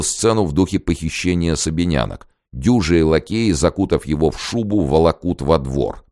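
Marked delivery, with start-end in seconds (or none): none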